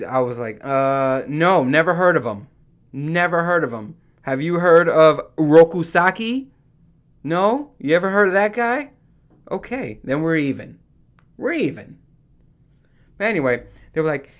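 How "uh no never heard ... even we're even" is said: a louder, higher mock retort; cocky mock comeback to smug coworkers; defiant comeback. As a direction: fire back, pleased with yourself.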